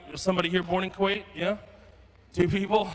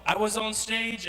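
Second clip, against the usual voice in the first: ordinary delivery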